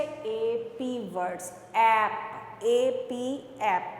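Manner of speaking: slow spelling demo. Shown as a demonstration. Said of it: "अब आप ध्यान से सुनिएगा".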